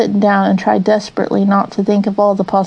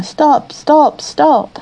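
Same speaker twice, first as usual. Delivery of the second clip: a raised, higher mock shout of pursuers; shouting stop; 6 semitones higher, much slower than usual. A narrator's reading. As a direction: call out stop like a crowd of police, stagey. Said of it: "all waving and shouting the same thing".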